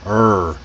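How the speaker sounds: er held low with the tongue curled back. Shown as a demonstration. Teacher showing how the tongue curls back, strong American R. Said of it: "Feel how your tongue curls back".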